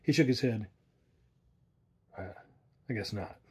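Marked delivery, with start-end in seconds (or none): 2.2-3.4 unconvinced. A narrator's reading. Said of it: "without overwhelming conviction".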